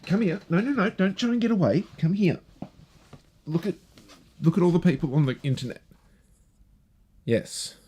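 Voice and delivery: talking to the dog. Real, not acted. Owner calling her barking dog, high coaxing pet voice. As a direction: coax the dog over in a high sing-song. Scolding dogs that barked on mic.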